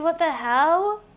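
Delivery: loud, high, drawn-out disbelief; mock outrage. Real, not acted. Cook reacting to being told of pregnancy, mock outrage. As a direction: stretch it out, rising in loud disbelief.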